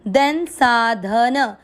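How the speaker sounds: साधन drawn out, each syllable full; very slow syllables. Shown as a demonstration. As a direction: spell out साधन slowly. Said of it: "दोनों अक्षर पूरी". (साधन धीरे-धीरे पूरे अक्षरों से)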